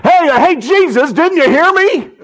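high, loud hollering for attention; calling out insistently. Acted. Blind man calling after Jesus who didn't answer, insistent shout.

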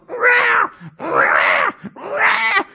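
high-pitched screeching cat meow; drawn-out suffering cat wail; imitated cat yowl. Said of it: "the cat going".

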